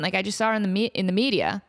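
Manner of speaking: ordinary talk, nothing marked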